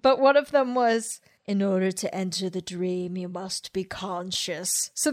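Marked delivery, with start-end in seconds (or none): 1.5-4.9 scary voice. Retold or imitated. Imitating the woman on the relaxation track, mildly scary voice. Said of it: "in a really kind of scary voice".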